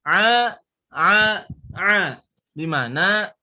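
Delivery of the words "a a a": a throaty ain drill; ain sound. Shown as a demonstration. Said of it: "Gitu ya".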